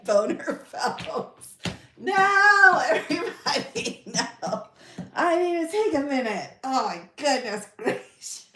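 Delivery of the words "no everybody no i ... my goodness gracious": high, loud protest breaking into laughter; cracking up mid-sentence; helpless laughter. Real, not acted. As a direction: try to talk through a real giggling fit. Helpless giggling fit after slip.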